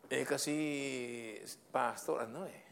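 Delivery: slow, sheepish stalling excuse, lightly voiced; hesitant excuse. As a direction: drag it out like you're hunting for a reason. Staff member stammers an excuse.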